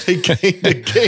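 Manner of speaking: laughing through the words. Real, not acted. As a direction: say it while laughing. Laughing echo of partner.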